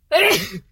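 sharp sudden cough; abrupt harsh cough; loud cough. Real, not acted. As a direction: hack it out loud. Irritation returns.

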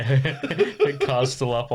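slow words squeezed through laughter; real laughing while talking. Real, not acted. Ribbing a schoolmate's mum to friend, laughing. Cracking up at own joke.

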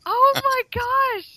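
high-pitched mortified disbelief. Real, not acted. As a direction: cry out, appalled and laughing. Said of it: "I am mortified".

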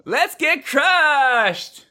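very high, long drawn-out party squeal; hammed-up Valley Girl party cry; Valley Girl student. Interviewer as high school partier, squealing Valley Girl. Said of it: "a Valley Girl, Californian high school student".

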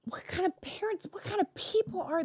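real indignant bafflement; incredulous outrage. Real, not acted. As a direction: pitch jumps up in appalled disbelief. Appalled reaction to parents' fear.

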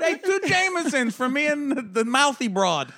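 loud, high, hammed-up bar order; exaggerated booming good cheer; mock boisterous drinker. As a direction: play a barfly delighted to order.

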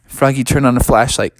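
usual speaking voice

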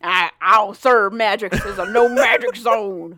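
slow, loud mock-angry refusal; hammed-up hostile shop owner; bigoted shopkeeper. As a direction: bellow it like a stubborn bigot. Mocking Norman's refusal to serve.

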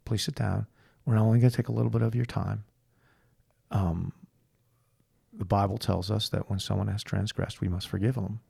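soft, measured imitation of pious calm; solemn retold appeal. Couple asks the mistress to hear them out, solemn appeal. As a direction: quietly act out a grave, polite request.

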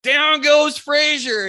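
high, loud, slow drawn-out yell; retold victory yell across the timber. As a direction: belt it out like a fight announcer. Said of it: "I yelled to Brad".

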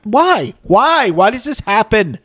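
high-pitched retold bewilderment; exasperated why. Sports fan retelling his reaction to [name] at playoffs, high exasperation. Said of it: "I'm like".

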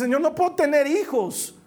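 high, pleading lament; mildly acted cry of grief; tearful plea. Childless Anna pleading before God, tearful. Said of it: "lloraba delante de Dios".

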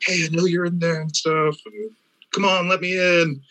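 high, pleading mock of the guy outside; high imitation of an insistent intruder; stalker at the window. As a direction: beg to be let in, banging on the glass. Stalker beating on bedroom window.

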